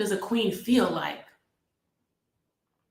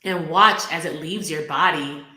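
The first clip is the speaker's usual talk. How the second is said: guided meditation. Acted. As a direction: guide them gently into the heart.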